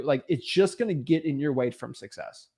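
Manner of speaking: usual speaking voice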